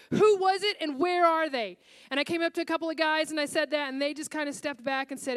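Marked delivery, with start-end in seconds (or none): 0.1-1.8 retold demand. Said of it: "I just said".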